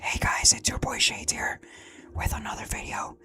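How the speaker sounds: soft, breathy ASMR whisper, jokingly. Pretend. Streamer mock-whispering his intro to viewers, ASMR style. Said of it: "I don't know why I'm talking like this".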